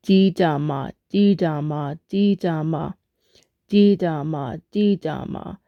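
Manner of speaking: "Didama" run together in even threes; steady pronunciation drill; joined-up repetition. Shown as a demonstration. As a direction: repeat "Didama" rhythmically, falling slightly each time. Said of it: "next part of the word is pronounced".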